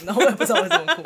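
high, loud, laughing through the words. Real, not acted. Narrator laughing as she recalls crying too, loud laugh. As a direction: laugh at your own silly tears.

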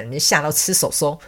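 normal talking voice

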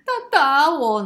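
very high, loud mock wail; exaggerated child's shriek of complaint. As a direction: cry out an accusation like a bawling kid. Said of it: "哭到哭天喊地".

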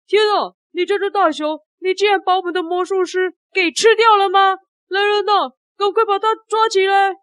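high-pitched indignant outburst; angry boss. Acted. Boss accusing the bear of eating the magician, high angry voice. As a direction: act outraged and order an arrest. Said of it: "老板很生气".

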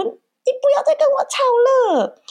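high, slow, crying exasperation; exasperated plea. Retold or imitated. Mother voices her inner wish to stop the fight, high and teary. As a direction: drag it out high as if near tears. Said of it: "我心里很想说".

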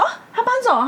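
surprised reaction when the landlord calls. Retold or imitated. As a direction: react as if just hearing the news. Hearing the roommate left.

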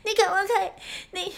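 high, loud sputtering anger; angry outburst. Retold or imitated. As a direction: snap at the driver and choke on it. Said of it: "我就更气了哦".

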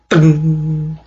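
ringing vocal sound effect; imitated bowstring twang. A narrator's reading. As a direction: make the sound of a released bowstring.

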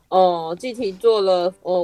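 ordinary talk

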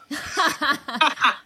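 short burst of real laughter; bright laugh. Real, not acted. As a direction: laugh out, amused. Laughs at his remark.

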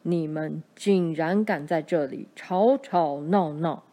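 low raspy giant bellowing; low hoarse roar. A narrator's reading. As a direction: growl it like an angry giant. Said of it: "他用低沉沙哑的声音大吼".

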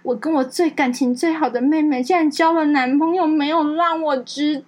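high, slow, whiny fake heartbreak; mock sobbing cousin. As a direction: whimper it like a hammed-up drama queen. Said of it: "她说".

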